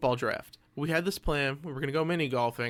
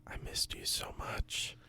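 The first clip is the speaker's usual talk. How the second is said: sweet nothings; 2 semitones lower, 15 dB quieter than usual. Pretend. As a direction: breathe it close like a lover. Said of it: "Whisper those sweet nothings to me".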